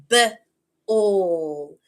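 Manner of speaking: "b" as short buh, pause, long "all"; onset-rime split. Shown as a demonstration. Teacher segments first sound, short "b" apart from long "all". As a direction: cut the word into buh and "all". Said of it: "segment that first sound".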